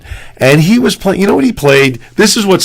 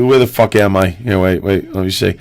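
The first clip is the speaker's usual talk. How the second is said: usual speaking voice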